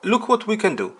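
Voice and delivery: normal talking voice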